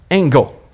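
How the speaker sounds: Angel with a hard G, ang-gel. Shown as a demonstration. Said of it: "Say angel with a strong G".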